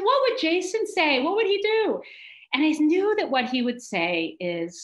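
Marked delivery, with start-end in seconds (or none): 0.0-2.0 higher pitched, eager asking; excited self-question. Retold or imitated. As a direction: ask it higher, hungry for an answer. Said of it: "So I said".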